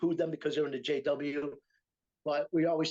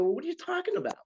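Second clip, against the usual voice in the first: much higher incredulous question; a hammed-up startled question; retold disbelief; faster than usual. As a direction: ask it as if you can't believe it. Retold confused question to Joe.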